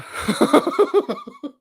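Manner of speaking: sudden high-pitched laugh. Real, not acted. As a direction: burst of giggling. Host laughs at chat.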